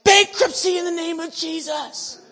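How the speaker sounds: high, drawn-out full-force prayer shout; screamed decree. Retold or imitated. Praying against the building in the street, screamed decree. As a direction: cry it out slowly at the top of your voice. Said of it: "screaming this".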